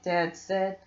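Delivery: plain reading voice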